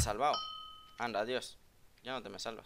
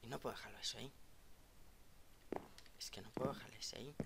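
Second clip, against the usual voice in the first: whisper; 11 dB quieter, much faster than usual. Real, not acted. Muttering near enemy.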